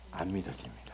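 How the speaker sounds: whispered punchline, no voice. Real, not acted. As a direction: drop to a bare whisper. Whispered punchline after question. (속삭이듯 안 믿어진다고)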